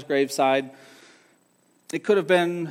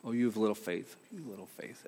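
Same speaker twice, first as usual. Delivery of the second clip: "Oh, you have little faith" murmured very softly to himself; repeating to himself; 7 semitones lower, 13 dB quieter than usual. Retold or imitated. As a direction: mutter "you little faith" under the breath, brooding. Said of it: "I kept repeating those words".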